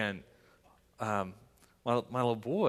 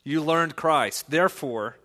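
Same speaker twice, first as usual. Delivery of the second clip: louder, rising preacher's call; full-force preaching; 6 dB louder than usual. Real, not acted. Pastor exhorts the Bridgeway church, loud preaching. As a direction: build to a ringing exhortation.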